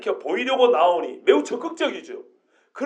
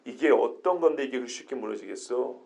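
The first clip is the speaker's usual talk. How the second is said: low, soft voice, arguing in disbelief; half-mutter how could this fall; low quiet objection; disbelieving protest; 12 semitones lower, 8 dB quieter than usual. Shown as a demonstration. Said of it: "우리가 반대로 생각해야 돼요".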